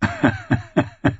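high staccato cackle; mocking chuckle in bursts; high, taunting laugh; villain's laugh. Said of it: "Der Bluthund lachte".

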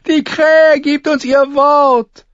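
slow, very high, loud mockery; high-pitched taunting shout; derisive jeer. A narrator's reading. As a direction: crow it scornfully after laughing. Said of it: "Der Ritter mit dem Stachelhelm lachte".

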